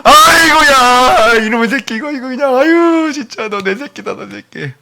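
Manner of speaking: father crying over his devoted son; weeping father. Acted. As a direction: sob with love for your child.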